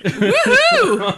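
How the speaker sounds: cheer. Real, not acted. Cohost whooping at the joke, laughing cheer.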